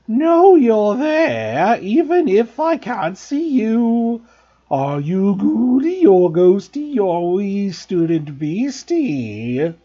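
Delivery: high, loud, very slow sing-song taunt; taunting poltergeist. Acted. Peeves taunting the invisible students, loud and slow. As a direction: draw out each word like a teasing spook.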